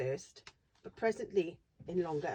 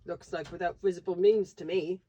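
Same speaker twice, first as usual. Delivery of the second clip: station master voice; 8 dB louder than usual. Acted.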